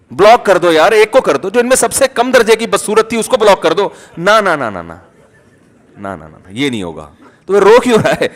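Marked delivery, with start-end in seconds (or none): 4.2-7.1 a hint of a stubborn sinner, low and soft; mock stubborn refusal. Preacher mimics stubborn worshipper, lightly voiced refusal. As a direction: play the sinner who won't give anything up.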